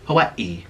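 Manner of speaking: Hawaii in parts, ha-wai-ee; native-style Hawaii. Shown as a demonstration. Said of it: "it's pronounced Hawaii".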